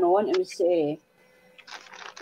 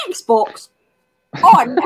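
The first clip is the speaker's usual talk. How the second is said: Scottish "Xbox on"; 2 semitones higher, 4 dB louder than usual. Retold or imitated. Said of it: "so he's got to go".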